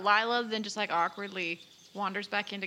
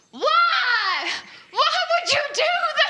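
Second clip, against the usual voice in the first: high, full-out yell from far away; 8 dB louder, slower than usual. Acted. Player acts a character heard yelling in the distance, loud high yell. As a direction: shriek it across the camp in outrage. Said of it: "just hear her yell".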